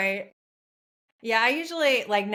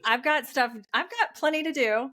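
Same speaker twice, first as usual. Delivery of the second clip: 1 sarcastic brush-off; 7 semitones higher than usual. Retold or imitated. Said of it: "It's like".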